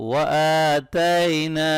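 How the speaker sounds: وَآتَيْنَا with the opening waa held long and slow; وَآتَيْنَا drawn out in chant; long vowel. Shown as a demonstration. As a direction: stretch the aa in وَآتَيْنَا. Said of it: "کھڑی زبر اور الف مدہ کو لمبا پڑھنا ہے".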